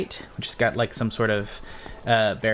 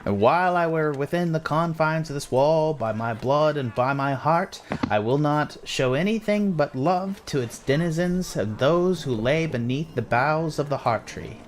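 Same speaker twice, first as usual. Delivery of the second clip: slow, higher, lilting pledge; drawn-out ceremonial recital; guard reciting an oath; 5 semitones higher, slower than usual. Acted. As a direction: intone the oath slowly in character. Guard recites second oath part.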